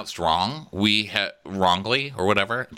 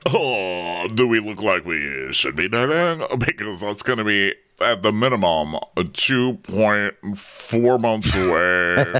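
slow, drawn-out mock answer in a put-on voice; hammed-up slow deliberation; put-on reality-show guy; slower than usual. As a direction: imitate him, dragging out the figures. Voices Carl answering dating question.

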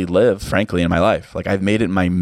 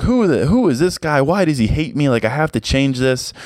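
higher, agitated self-quote, half-mocking; riled-up retort; 7 semitones higher than usual. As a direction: replay your own riled-up panic, lightly hammed. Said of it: "I got all riled up big time".

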